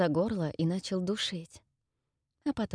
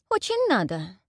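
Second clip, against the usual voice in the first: exclaimed; 3 semitones higher, 3 dB louder than usual. A narrator's reading. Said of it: "воскликнула я".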